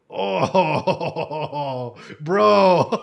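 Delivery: laughing through being moved. Real, not acted. Moved reaction to the letter.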